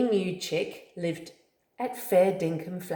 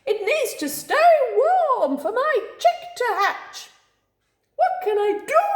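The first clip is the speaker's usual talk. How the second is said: very high, loud, rising panic; frantic screech; 6 dB louder than usual. Acted. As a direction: plead in a frantic bird voice. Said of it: "screeched Fluster".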